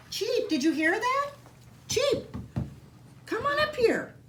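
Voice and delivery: high, sing-song call to a pet; high-pitched pet talk to the dog. Real, not acted. Calling her dog up to her.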